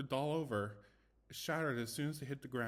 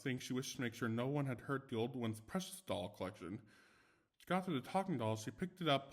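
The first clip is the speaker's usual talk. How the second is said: ordinary delivery, nothing marked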